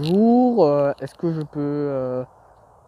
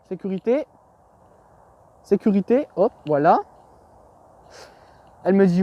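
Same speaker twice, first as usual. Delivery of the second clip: ordinary talk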